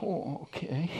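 small, soft, high "okay"; mock meek acceptance. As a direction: give in with a tiny put-on whimper. Reluctantly accepts the rebuking verse.

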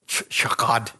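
shakad said slowly; Hebrew word. Shown as a demonstration. Pastor teaching the congregation the Hebrew word, said carefully. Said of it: "Here's the Hebrew word for mercy".

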